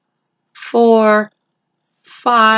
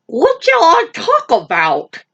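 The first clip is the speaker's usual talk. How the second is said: eager rabbit; 8 semitones higher than usual. Acted. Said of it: "he asked eagerly".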